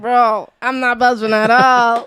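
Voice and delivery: mock exasperated. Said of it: "I'm like".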